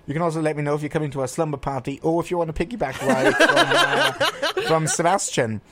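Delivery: fast, laughing, with a high squeak mid-line; laughing through it. Real, not acted. Host teasing listeners about a piggyback ride, laughing. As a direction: giggle your way through the plug.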